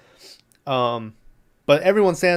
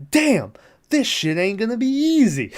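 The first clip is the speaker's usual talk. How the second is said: hammed-up movie character, pitched well up; exaggerated exclamation; 9 semitones higher than usual. Retelling Johnny's reaction to the plan, exaggerated exclamation.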